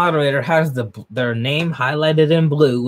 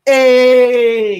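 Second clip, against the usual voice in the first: long, high, loud whoop; loud hype-man holler; hyped cheer; 10 semitones higher, 9 dB louder than usual. Real, not acted. Cheering a character's bold line.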